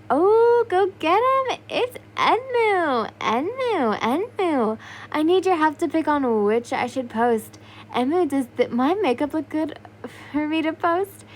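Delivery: high, loud gushing fangirl; excited fangirl. Acted. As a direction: squeal like a starstruck fan. Fangirls mob him with cameras.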